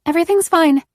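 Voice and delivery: shaking voice forcing calm; shaky voice. A narrator's reading. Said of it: "her voice shaking".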